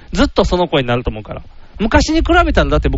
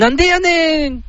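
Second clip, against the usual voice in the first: synthetic voice; 12 semitones higher than usual. Pretend. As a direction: tsukkomi in a high synthetic tone. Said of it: "初音ミク的なやつ入れて". (初音ミク風の高い機械声)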